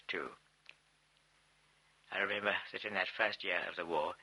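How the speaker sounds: ordinary delivery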